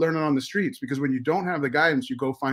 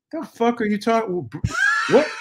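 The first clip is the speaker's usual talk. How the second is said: high-pitched, indignant disbelief; retold incredulous outburst; 9 semitones higher, 3 dB louder than usual. Son reacting inwardly to his mother's Brooklyn put-down, incredulous. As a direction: recount being totally baffled, pitch jumping up.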